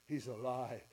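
a quiet dawning that he lives; quiet realisation. Acted. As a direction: let it sink in quietly. Dawning realisation, then repeated.

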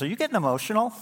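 high teasing wife voice. Retold or imitated. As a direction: tease him like his wife did. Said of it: "She goes".

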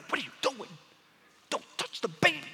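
angry little sheep. Retold or imitated. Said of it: "And he's like".

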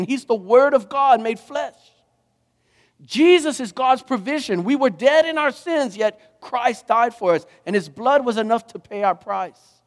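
high, ringing sermon climax; fervent preaching. Acted. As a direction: proclaim each line with conviction.

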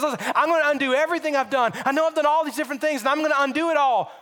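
acted hurried, insistent plea; frantic promising. Acting out a debtor's frantic promises.

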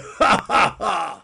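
loud, high cackle; bully's triumphant laugh at his own joke; gloating laugh. A narrator's reading.

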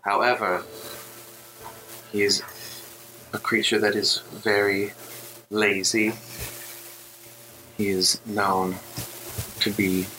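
a hint of an eerie voice in the mind; echoing female voice. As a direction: speak like a distant voice echoing in the head. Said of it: "female voice kind of echo in your mind".

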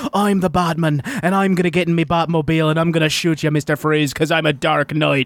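put-on a light Irish-accented Batman; Colin Farrell as Batman. Cohost imitates Colin Farrell playing Batman, lightly Irish. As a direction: Batman with a hint of Irish brogue. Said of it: "my impression when I found out Colin Farrell was almost Batman".

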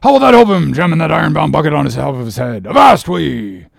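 high, loud, fast tirade; an officer bawling out a sailor; furious scolding. Acted. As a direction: tear into him for his blunder. Stubb berates Dago mid-rescue.

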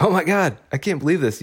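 a squeal of thrilled disbelief; overjoyed disbelief. Retold or imitated. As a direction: gush it like the best news ever. Thrilled to be enrolled.

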